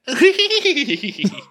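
high-pitched, loud burst of giggles; real high tittering laugh. Real, not acted. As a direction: squeal with laughter. Cracking up after the impression.

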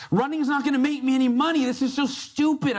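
high, whiny self-pity; negative inner grumbling. Retold or imitated. To himself on a hated first run, whiny griping. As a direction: grumble at yourself mid-run, sulky and disgusted. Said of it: "very negative internal dialogue".

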